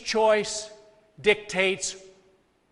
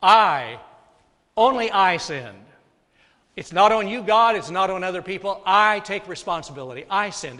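loud, insistent owning of guilt; forceful preacher's confession; emphatic confession; 3 semitones higher, 7 dB louder than usual. Retold or imitated. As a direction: hit every I like a verdict on yourself. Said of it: "it's emphatic".

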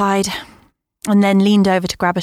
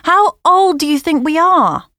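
indignant; 10 semitones higher, 5 dB louder than usual. Acted. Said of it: "I protested".

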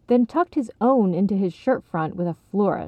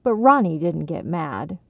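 ordinary delivery, nothing marked

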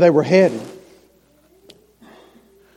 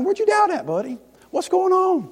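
gentle question; 11 semitones higher, 7 dB quieter than usual. Acted. Jesus asking tenderly.

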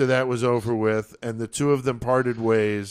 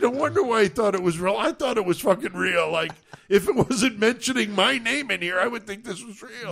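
high, hurried mock disbelief; freaking out; 7 semitones higher than usual. Tom admits the letter fooled him, high retold freak-out. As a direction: imitate someone flustered, pitched high. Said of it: "Tom is freaking the fuck out".

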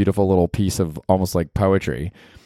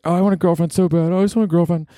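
higher, whining complaint; mock-pining for a girlfriend; whiny longing; 10 semitones higher than usual. Mocking himself pining for a girlfriend, whiny. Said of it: "'cause I'm always like".

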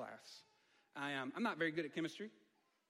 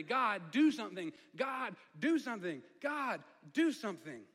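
loud, higher, desperate plea, slowing at the end; crying out; 4 semitones higher, 8 dB louder than usual. Retold or imitated. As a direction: cry out to God with everything. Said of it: "he's crying out internally".

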